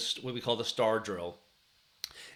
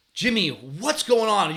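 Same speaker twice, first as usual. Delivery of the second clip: higher, louder, drawn-out scolding; frustrated yell; 6 semitones higher, 5 dB louder, slower than usual. Retold or imitated. Frustrated coach yelling at his moping first baseman, scolding yell. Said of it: "I start yelling at him".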